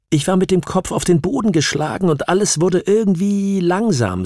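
high-pitched voice of a troubled witness; distressed witness. Acted. Witness recalling the attack to her questioner, distressed and high.

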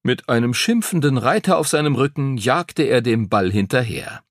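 ordinary delivery

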